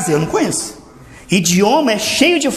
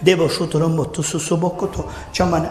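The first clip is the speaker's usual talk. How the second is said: quiet tongues demo; 7 semitones lower, 6 dB quieter than usual. Shown as a demonstration. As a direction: speak the tongues low and gentle, no shouting. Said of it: "Se eu falar".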